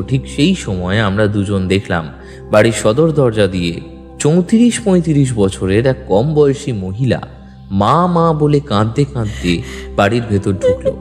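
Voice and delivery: usual speaking voice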